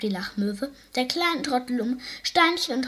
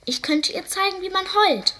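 eager offer; 9 semitones higher, 4 dB louder than usual. Acted. Seal eagerly offers to teach.

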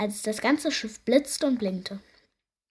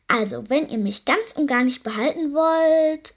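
pondering; 3 semitones higher, 6 dB louder than usual. A narrator's reading. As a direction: make a show of considering. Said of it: "überlegte Lottchen Pfannenstiel". (gedehnt überlegend)

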